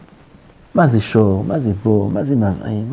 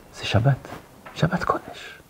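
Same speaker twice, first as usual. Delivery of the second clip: whispered; 9 dB quieter than usual. Shown as a demonstration. Whispered in wonder.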